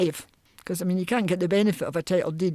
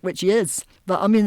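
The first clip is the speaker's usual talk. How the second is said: usual speaking voice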